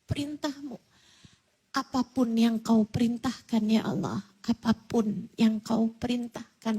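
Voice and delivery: quiet, faltering prayer, nearly whispered at points; hushed prayer. Acted. Ibrahim prays softly to God before the sacrifice, hushed. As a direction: pray under the breath with the blade ready.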